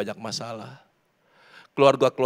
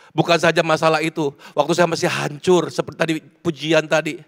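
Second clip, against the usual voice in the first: loud, building testimony, pitch climbing; impassioned preaching; 6 semitones higher, 8 dB louder than usual. Real, not acted. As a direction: confess past sins with rising force. Preaches at full force. (khotbah lantang yang makin memuncak)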